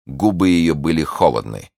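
ordinary delivery

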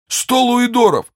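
high, strained voice of a wounded bandit; frightened bandit. Acted. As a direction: plead in a thin high voice.

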